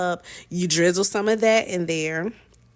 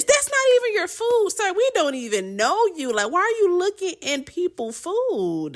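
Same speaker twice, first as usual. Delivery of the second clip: high, baffled protest; exasperated telling-off in a high voice; incredulous scolding; 12 semitones higher than usual. Real, not acted. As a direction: go way up in pitch, appalled. Scolding the food snooper in retelling.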